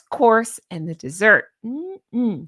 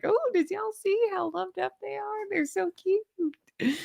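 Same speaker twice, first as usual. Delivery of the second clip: giddy Southern gush; 10 semitones higher, 4 dB quieter than usual. Retold or imitated. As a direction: imitate yourself getting giddy and Southern. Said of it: "giddy, I'm like".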